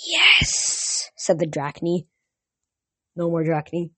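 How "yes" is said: high, hissed monster voice; snake-lady voice. Retold or imitated. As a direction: imitate a serpent woman with a long hiss. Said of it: "to get into the voice".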